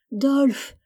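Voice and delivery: crying out a name in pain; desperate cry. Acted. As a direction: wail the name, rising high. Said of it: "criait sans cesse Rieg-G". (appel désespéré)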